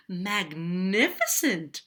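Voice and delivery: loud, stretched-out admiration; big impressed exclamation; excited praise. A narrator's reading. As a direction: gush it slowly, soaring in the middle. Princess cheers the dragon on.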